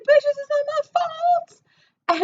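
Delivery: very high, drawn-out loud screech; reenacted high-pitched yell; retold yelling back. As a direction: screech it slowly, far above your voice. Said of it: "Like, still yelling at me".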